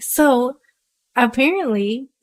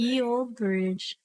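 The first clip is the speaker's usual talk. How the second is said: mock old-timey; 9 dB quieter, much slower than usual. Joking about the bridge tool.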